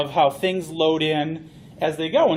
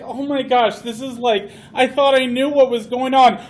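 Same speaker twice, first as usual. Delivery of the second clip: higher, flustered panic; mock overwhelm; 6 semitones higher, 5 dB louder than usual. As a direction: sound swamped and overwhelmed. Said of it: "And you're like".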